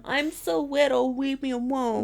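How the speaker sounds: put-on high, slow, babyish plea; mock helpless little one. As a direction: plead in a tiny put-on voice. Pretends to be small and helpless.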